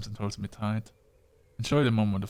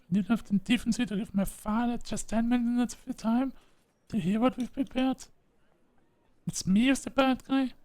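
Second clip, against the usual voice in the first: put-on higher voice for an angry rival girl; female character voice; 9 semitones higher, 3 dB louder than usual. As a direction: scold her in a high, cutting girl's voice.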